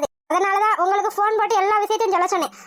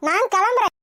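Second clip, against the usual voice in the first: normal talking voice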